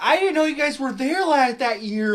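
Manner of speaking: high, whiny mocking voice; squeaky mock complaint; mock whiny attendee. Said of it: "people who were like".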